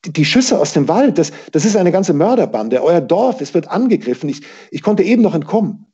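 high, hurried, stammering alarm; out of breath. A narrator's reading. Peter warning the village woman, out of breath. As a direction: blurt the warning in breathless bursts. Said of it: "noch immer außer Atem".

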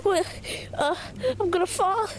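usual speaking voice

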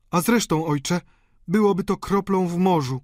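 high-voiced daughter's objection; countess objecting. A narrator's reading. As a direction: point it out to your father, pitched high. Countess voiced answering her father.

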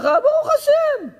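very high, slow, squeaky "ברוך השם"; "ברוך השם" בקול גבוה מאוד, איטי וצייצני; squeaky high reply. Retold or imitated. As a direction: pipe up "ברוך השם" high and drawn out, as if forcing it. Asked about his wife.

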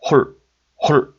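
홀 said as one short, quick syllable; short sound. Shown as a demonstration. Teacher showing ㄹ added under 호, 홀 kept short. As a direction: cut 홀 off briskly, each take separate. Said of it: "이런 짧은 소리입니다".

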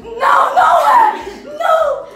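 high imitated shout; high, exaggerated mock yell; retold protest. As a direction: mimic someone crying out in denial. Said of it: "ele fez".

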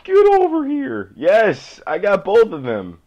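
high, loud yell of triumph; excited screaming. Real, not acted. Gamer catching both cells, excited high scream. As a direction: scream it out as you chase and catch. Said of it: "feels like I'm screaming".

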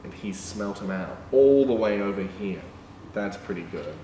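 ordinary delivery

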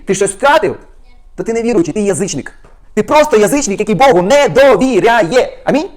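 loud, rising rebuke to the flock; fired-up preaching. Real, not acted. Preacher rebuking unbelievers, worked up and loud. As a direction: scold them from the pulpit, carried away. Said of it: "бачите, як я зайшов, завівся, да?".

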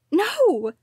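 high, loud anguished cry. A narrator's reading. Daughter crying no at the gravestone. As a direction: wail it out. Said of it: "I cried".

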